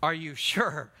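wary, deliberate double-check; hesitant. Acted. Dropped teen questioning his buddies before second fall, hesitant. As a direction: press them with measured doubt. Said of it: "I'm very hesitant to be able to trust them again".

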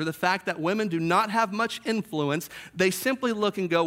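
ordinary delivery

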